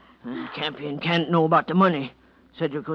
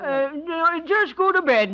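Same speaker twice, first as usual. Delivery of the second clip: nervous; 12 semitones higher, 4 dB louder than usual. Acted. Nervously getting rid of boarder.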